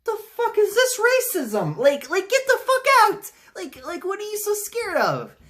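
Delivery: very high, loud, put-on squeal of disbelief; incredulous outburst. Reviewer mocks Venom's fear of Carnage, a very high incredulous squeal. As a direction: shriek it like you can't believe it. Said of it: "I'm like".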